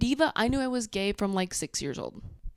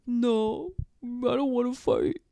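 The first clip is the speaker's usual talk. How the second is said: whiny baby imitation; 5 semitones higher, slower than usual. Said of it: "And he's like".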